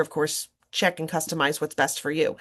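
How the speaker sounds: normal talking voice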